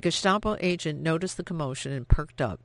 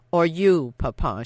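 defiant retort; 7 dB louder than usual. Acted. Daughter's retort to father.

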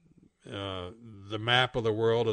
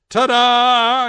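high, loud, long-held showy cry; triumphant reveal; 12 semitones higher, 14 dB louder than usual. A narrator's reading. The world shows Pig its drawn smile, triumphant. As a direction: sing it out like a stage flourish.

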